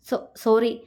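usual speaking voice

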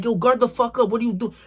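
high, exasperated call to guard up; yelling at teammate. Retold or imitated. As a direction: bark at your teammate to get on defense. Yelling at friend on court.

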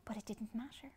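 soft whisper; quiet whispered aside. A narrator's reading. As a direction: hush it gently. Cheerful turn after setback.

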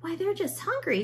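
high-voiced mayor declaring; lightly voiced mayor. A narrator's reading. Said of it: "declared the mayor".

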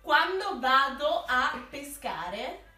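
slow, spelled-out words; slow, over-articulated. Shown as a demonstration. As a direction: mouth each word slowly. Repeating slowly for lip-reading. (scandito lentamente)

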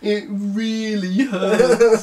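very slow, high, laughing through the fake pain; laughing mock agony. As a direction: stretch it out while cracking up.

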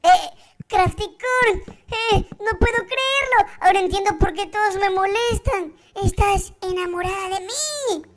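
bright, high and eager, with rushed questions; a nervous, excitable young character; high-pitched character voice. Acted. Same character, surprised realization.

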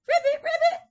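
loud, very high ribbits; frog noise. Acted. Teacher voices boy playing frog, high loud ribbit. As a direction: squeal like an excited little frog.

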